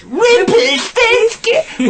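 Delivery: very high, slow stretched "Rumpelstiltskin!"; exaggerated catchphrase. Mocking the friend's version, very high and slow. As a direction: overblow the name, drawn out. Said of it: "in your head, he goes".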